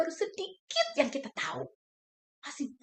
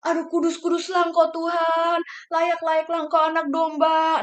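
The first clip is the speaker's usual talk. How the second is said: loud worship cry; 4 semitones higher, 9 dB louder than usual. Retold or imitated. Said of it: "Sampai kita".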